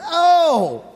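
loud, high re-enacted howl; hammed-up pained holler; cry of pain. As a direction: act out a sudden muscle pull. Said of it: "I'm in pain".

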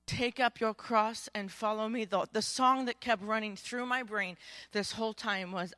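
ordinary talk, nothing marked

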